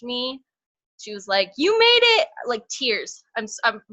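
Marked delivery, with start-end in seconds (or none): 1.6-2.3 much higher and louder, bursting with joy; joyful announcement. Retold or imitated. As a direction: shout the good news like a thrilled friend. Friend messaging news of promotion.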